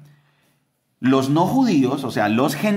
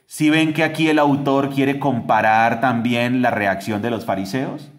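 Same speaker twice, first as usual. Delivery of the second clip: ordinary delivery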